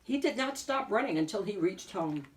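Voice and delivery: usual speaking voice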